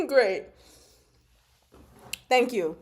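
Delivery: ordinary talk, nothing marked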